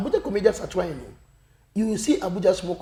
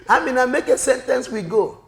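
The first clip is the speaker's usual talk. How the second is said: loud, higher acted classroom order; principal's command; 8 semitones higher, 8 dB louder than usual. Principal tells Amina to make a sentence, loud command. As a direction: order a pupil, raised voice.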